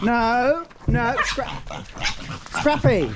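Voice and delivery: high, urgent commands; high-pitched cry to stop; calling the dog off. Real, not acted. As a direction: call out sharply to the dog.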